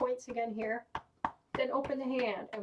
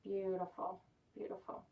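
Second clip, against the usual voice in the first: guided meditation; 3 semitones lower than usual. Acted. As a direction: guide them gently inward. Guided relaxation.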